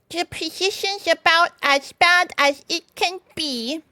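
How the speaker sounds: high, slow grumbling character voice; grumpy Mole. Acted. Mole answering Rat, grumpy and high. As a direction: grumble it out slowly, pitched high. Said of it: "replied the mole grumpily".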